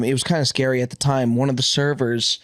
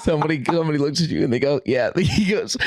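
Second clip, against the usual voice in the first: ordinary talk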